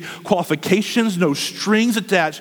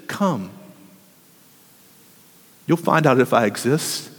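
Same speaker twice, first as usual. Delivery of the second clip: gentle invitation; 6 semitones lower than usual. Acted. Preacher voices Jesus inviting gently.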